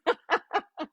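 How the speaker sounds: quick high giggle; short laugh. Real, not acted. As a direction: burst out laughing. Laughs after joking with viewer.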